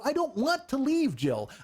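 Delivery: high-pitched retold protest; exasperated protest. Refusing girlfriend's offer to leave, exasperated protest. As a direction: push back, pitch jumping up.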